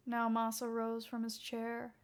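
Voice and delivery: ordinary delivery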